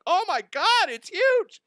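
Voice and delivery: high, loud mock squeal; mock shriek. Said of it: "He's like".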